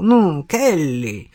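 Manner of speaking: drawn-out coaxing, slow and patient; slow urging. Acted. Detective slowly urging Kelly to answer, coaxing. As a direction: draw out the name to press her. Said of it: "поторопил он".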